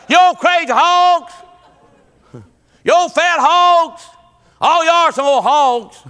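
loud, high call to livestock; mock holler. Preacher playing someone yelling at hogs, high mock holler. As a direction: holler it out in playful scolding.

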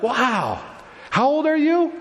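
high-pitched amazed question. Acted. Astonished teachers questioning a twelve-year-old, amazed. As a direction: marvel at it, pitch jumping up. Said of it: "they would have been amazed".

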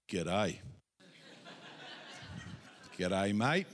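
low G'day with a rising end; practising being Australian. Acted. Preacher mimicking an Australian practising at morning, low rising greeting. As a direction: rehearse an Aussie greeting, low and tentative. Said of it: "Let's practice together".

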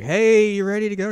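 high, loud sing-out to a sister; brother calling out. Retold or imitated. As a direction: call out brightly in a high voice. Brother calls to his sister.

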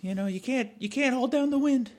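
sidle up and sell yourself. Acted. Girlfriend still in voice.

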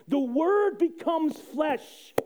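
normal talking voice

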